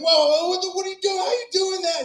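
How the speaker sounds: high-pitched astonished questioning; amazed kid. Retold or imitated. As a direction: imitate a kid who can't believe it. Acts out amazed kid questioning him.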